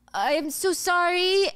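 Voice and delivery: high, drawn-out apology; apologetic escape. Acted. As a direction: excuse yourself while edging away. Driver apologizes while fleeing.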